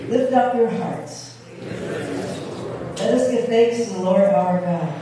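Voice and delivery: ordinary talk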